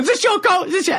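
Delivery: very high imitated question; high mock holler, asking around; crowd calling out. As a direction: mimic people shouting across a room. Crowd hunting for the coat.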